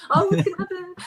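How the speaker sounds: high, laughing eager question; eager fan. Retold or imitated. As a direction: play the thrilled reader. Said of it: "people that email me ... and go".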